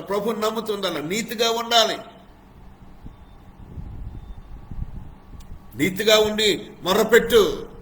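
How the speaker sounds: fast, high, loud preacher's exhortation; full-force preaching. Acted. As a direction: command them to cry out to God.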